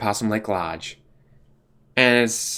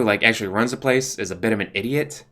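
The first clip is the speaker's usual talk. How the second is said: usual speaking voice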